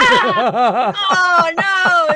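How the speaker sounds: loud burst of laughter, then a laughing no; cackling loud in disbelief; shocked laughing. Real, not acted. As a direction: crack up in horror.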